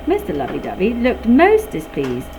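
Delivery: ordinary delivery, nothing marked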